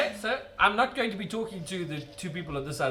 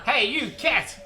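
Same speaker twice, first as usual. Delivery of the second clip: calling out; 9 semitones higher, 7 dB louder, slower than usual. Acted. Calling out to the cat.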